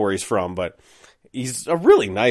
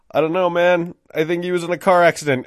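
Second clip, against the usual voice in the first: higher-pitched, badly faked concern; manufactured emotion; 7 semitones higher than usual. Narrator lying to manager about friend's accident, faked concern. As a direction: feign worry for an injured friend. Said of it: "I tried to, like, manufacture some emotion".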